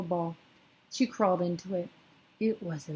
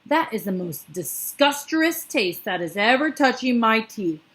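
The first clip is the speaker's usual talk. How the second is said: loud raging giant voice, getting higher; furious giant; 4 semitones higher, 7 dB louder than usual. A narrator's reading. Bloodbottler rages at the BFG, loud and furious. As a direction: rage at the taste.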